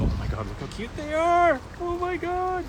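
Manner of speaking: very high cooing squeal; real high-pitched delight; gushing over cute birds. Real, not acted. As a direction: melt at how cute they are. Sees cute birds on pond.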